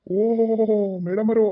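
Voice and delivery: high, loud, teasing oho; mock surprise. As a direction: fake amazement to tease the wife. Husband mock-greets his wife. (ಹೆಂಡತಿಯನ್ನು ಕಿಚಾಯಿಸುತ್ತಾ ನಾಟಕೀಯ ಆಶ್ಚರ್ಯ)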